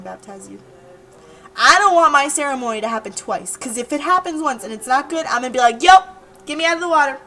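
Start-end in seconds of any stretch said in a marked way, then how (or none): 5.8-7.2 loud, high burst easing off; emphatic call-out. Acted. Candidate quitting a bad baptism ceremony, loud mock call-out. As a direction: call it off loudly and decisively.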